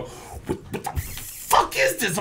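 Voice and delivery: high squealing what the fuck, as if spitting it out; shocked disgust. Retold or imitated. Retelling his first sour beer to friends, hammed-up high-pitched disgust. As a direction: play the disgusted first sip big and high. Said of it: "and I go".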